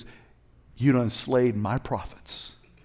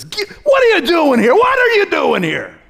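much higher, loud shout; God confronting Elijah, thundering; booming God voice; 11 dB louder than usual. Acted. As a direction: call him out like God. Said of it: "God says".

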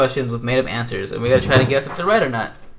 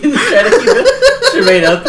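high, loud laugh through words; laughing too hard to talk; 12 semitones higher, 9 dB louder, slower than usual. Real, not acted.

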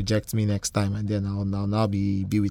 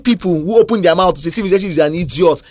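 indignant rant; 8 semitones higher, 7 dB louder than usual. Real, not acted. Ranting about hypocritical critics.

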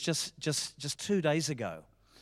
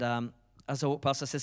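normal talking voice

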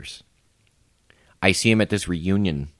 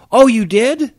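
mock surprise, pitched higher and louder; sarcastic; 9 dB louder, slower than usual. Sarcastically busting the ex-employee at a reunion, mock-surprised. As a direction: fake amazement to needle him. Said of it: "I'm being sarcastic".

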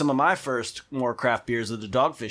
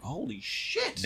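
stunned student; 8 semitones higher, 9 dB quieter, much slower than usual. Retold or imitated. Student's shocked reaction.

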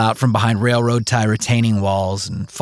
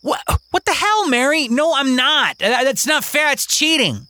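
put-on high, whiny protest of a boy; indignant kid. Kingston protesting to Mary, high indignant whine. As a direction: complain that it's not fair.

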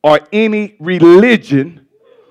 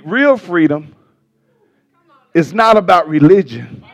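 ordinary delivery

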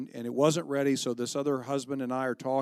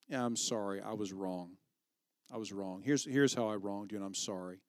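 sincere apology; 5 semitones lower, 6 dB quieter than usual. Pretend.

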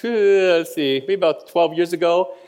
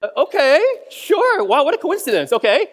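mock surprise; 9 semitones higher, 3 dB louder than usual. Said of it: "And I was like".

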